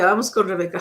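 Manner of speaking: ordinary talk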